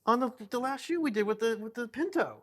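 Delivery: indignant. Real, not acted. Mock outrage at husband.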